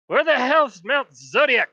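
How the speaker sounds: a gate guard squinting at the bard; gruff guard. Acted. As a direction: demand it like a baffled gate guard.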